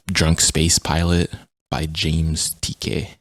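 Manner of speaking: ordinary delivery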